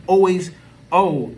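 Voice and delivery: normal talking voice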